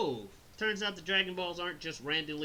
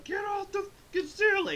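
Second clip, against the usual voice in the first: high-pitched, louder retold yell; mock yell; 5 dB louder than usual. Pikkon ordering him off the ceiling, mock yell. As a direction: holler it in a put-on high voice. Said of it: "yells at him".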